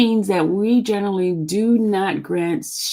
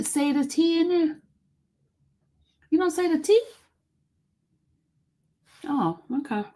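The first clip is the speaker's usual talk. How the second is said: ordinary delivery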